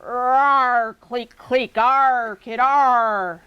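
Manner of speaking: loud growling alien clicks and snarls; snarling alien. Acted. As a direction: snarl it out like a rodent creature. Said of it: "A snarl bubbles out of his throat".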